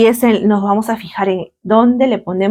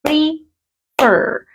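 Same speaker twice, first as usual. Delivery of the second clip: Prefer in two separate beats, PRE, then FER; syllable by syllable; 6 semitones higher than usual. Shown as a demonstration. Teacher counting two syllables for the class, Prefer split apart. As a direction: break Prefer apart with a clear gap, higher pitched. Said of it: "Tiene dos".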